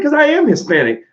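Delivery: high-pitched retold indignant retort; imitated mad girlfriend. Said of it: "one day she got mad".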